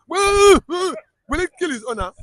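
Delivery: ordinary delivery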